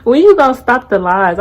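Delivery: exasperated loud confrontation; loud challenge. Real, not acted. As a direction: raise your voice, calling out the lies. Confronting scammer, raised voice.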